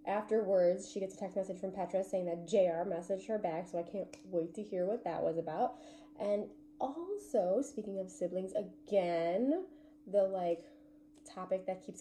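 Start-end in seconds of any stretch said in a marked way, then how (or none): none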